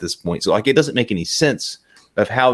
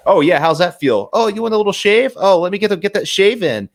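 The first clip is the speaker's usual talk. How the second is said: Jason chatting normally; 6 semitones higher than usual. Acted. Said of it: "Jason was talking normal".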